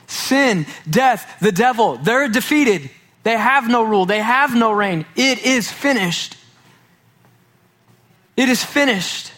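high, ringing sermon climax; fervent preaching. Acted. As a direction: preach it with full conviction. Preaching at full force.

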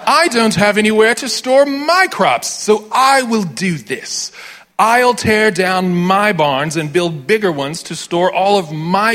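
high, loud, slow hammed-up British snob; mock British accent savouring every word. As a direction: play the greedy British toff. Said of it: "distinctly British".

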